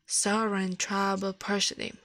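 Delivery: ordinary delivery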